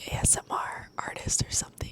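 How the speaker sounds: soft breathy whisper right into the mic; ASMR whisper. Real, not acted. Vlogger jokes about her new mic, ASMR whisper. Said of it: "I'm talking different with this microphone".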